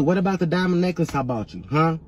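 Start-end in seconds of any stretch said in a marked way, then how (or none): none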